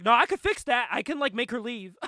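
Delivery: loud, high mock protest; insistent protest. Retelling his argument with Pops, loudly insistent. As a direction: push back loudly, as if imitating an argument.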